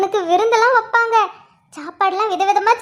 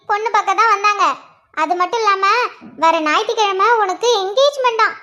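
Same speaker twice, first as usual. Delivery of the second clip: ordinary talk, nothing marked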